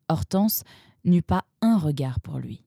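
ordinary delivery

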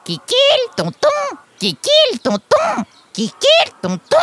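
very high, squawking parrot voice; Lulu. A narrator's reading. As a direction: parrot parroting words in loud squeals. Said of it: "fit Lulu, en battant des ailes". (cris de perroquet suraigus)

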